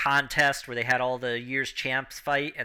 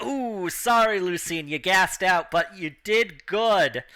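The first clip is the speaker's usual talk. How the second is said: raised, consoling announcer voice; 4 semitones higher, 3 dB louder, slower than usual. Acted. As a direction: announce it with sympathy, drawing out the end. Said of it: "the announcer comes out and is like".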